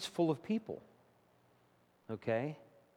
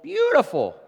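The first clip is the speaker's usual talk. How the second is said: awed; 12 semitones higher, 7 dB louder than usual. Acted. Admiring the mansion.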